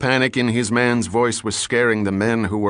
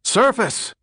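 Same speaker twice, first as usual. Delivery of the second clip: shouted order; 13 semitones higher than usual. Acted. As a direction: bark the order up at full voice. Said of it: "shouted Dick Condon".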